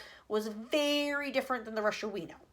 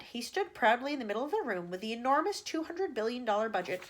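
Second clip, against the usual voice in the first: usual speaking voice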